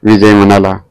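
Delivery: ordinary delivery, nothing marked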